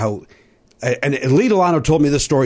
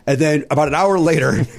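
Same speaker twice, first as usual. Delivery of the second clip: ordinary delivery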